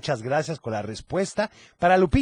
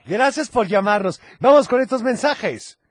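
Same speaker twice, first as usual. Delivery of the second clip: ordinary talk, nothing marked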